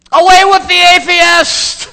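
slow, high, full-force bellow; drawn-out shout at the top of the voice; defiant shout. Acted. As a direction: hurl it at an angry mob. Polycarp turns on the mob.

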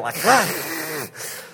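lakach with the final k dropped, trailing off; fumbled lakach. Shown as a demonstration. Pastor admitting he can't say the Hebrew lakach. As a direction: lakach half-said, fading at the end. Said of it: "I can't say it".

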